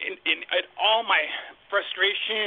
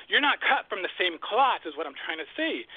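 ordinary talk, nothing marked

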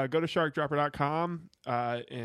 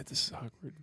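ordinary delivery

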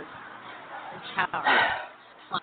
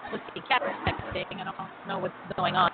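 normal talking voice

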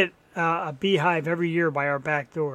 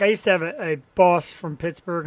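ordinary delivery

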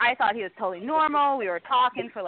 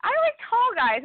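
exasperated; 12 semitones higher, slower than usual. Real, not acted. Exasperated about short dates.

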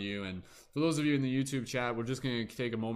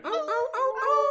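high, far-carrying howls; wolf howl; 7 dB louder than usual. Pretend. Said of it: "wolf howl that we're gonna do".

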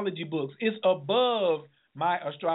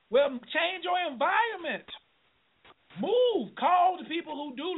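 fired-up exhortation; 9 semitones higher than usual. Real, not acted. Host exhorting listeners forcefully.

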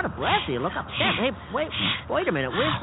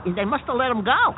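ordinary delivery

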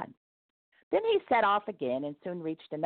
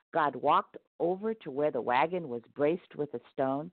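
ordinary delivery, nothing marked

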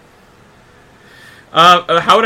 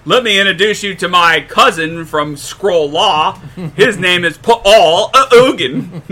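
slow, drawn-out Aussie parody building louder; mock Australian accent; 5 dB louder, slower than usual. Australian Skrull introduces his cousin, mock Australian accent. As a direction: ham up an Australian introduction, near laughter. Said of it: "this Skrull has a thick Australian accent".

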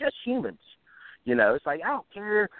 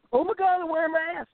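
mocking whine; 10 semitones higher, faster than usual. Mocking fake people he hated.

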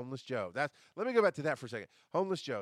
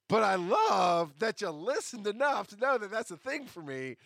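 louder, higher mock tirade at the sock senders; mock rant; 5 semitones higher, 6 dB louder than usual. Ranting at listeners who mailed him socks, loud mock outrage. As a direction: shout it like a fond fake scolding.

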